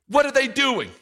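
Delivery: high sneering mockery; mocking. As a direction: sneer it like a scornful enemy. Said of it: "He was mocking them".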